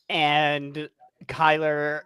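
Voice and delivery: normal talking voice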